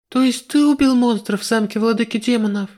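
high startled outburst; shocked cry. Acted. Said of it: "мой сорвавшийся с губ крик".

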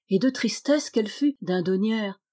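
ordinary delivery

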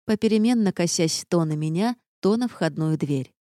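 ordinary delivery, nothing marked

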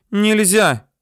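put-on high, forceful girl's protest; high narrated shout of a girl; sharp cry. A narrator's reading. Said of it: "вдруг резко крикнула девочка".

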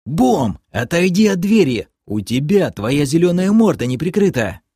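high-pitched, urgent order; commanding. A narrator's reading. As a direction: boss the half-orc away from the door. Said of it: "я продолжал командовать".

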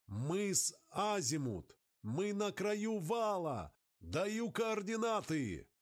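distant radio voice. Acted. Radio voice calling out position.

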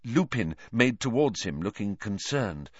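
ordinary delivery

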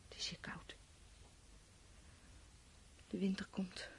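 whispered, breath fading. Acted. Dying woman whispers alone in the cold. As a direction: barely voice it, drifting off.

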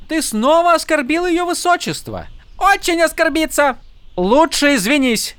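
high, scolding voice; indignant attendant. A narrator's reading. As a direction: play the outraged servant. Tyn scolding the soldier.

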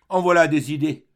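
sour. A narrator's reading. Old maid scolding a life-weary young man, with sourness. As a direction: sour exclamation, loudly dismissing him. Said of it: "reprit-elle avec une sorte d'aigreur".

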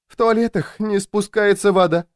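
high, plaintive complaint; mild tearful whimper. A narrator's reading. Louisa complains about her torments, lightly tearful. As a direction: voice it as if close to tears, understated. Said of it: "всхлипнула женщина".

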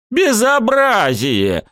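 high, slow outraged bellow; roaring outrage. A narrator's reading. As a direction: roar it like a wounded boar. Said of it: "раненым Боровым взревел".

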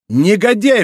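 high, loud outraged exclamation; angry cry. A narrator's reading. Aramis firing at the murderer, angry shout. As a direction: hurl the insult. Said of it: "вскричал Арамис".

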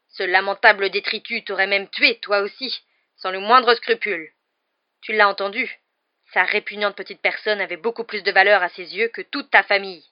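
loud, contemptuous denunciation; angry growl. A narrator's reading. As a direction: drive it home with disgust. (grondement de mépris)

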